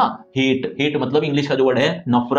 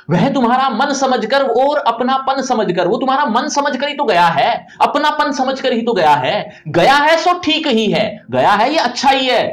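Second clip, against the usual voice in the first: a lightly voiced mother, higher and gentle; consoling mother; 7 semitones higher than usual. Teacher voices the mother consoling the weeping father, lightly raised. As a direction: soothe a crying husband, raised and caring.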